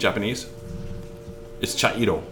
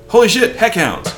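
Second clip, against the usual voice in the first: high, loud cry of alarm; hammed-up shout of shock; acted alarmed exclamation; 11 semitones higher, 7 dB louder than usual. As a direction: yell it like a hero spotting monsters. Redoing the alarm with cleaned-up words.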